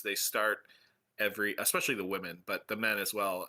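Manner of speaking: ordinary talk, nothing marked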